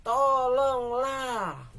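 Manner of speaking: high, very slow, loud begging cry; long anguished cry for help; drawn-out plea. Acted. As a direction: stretch the plea out in desperation.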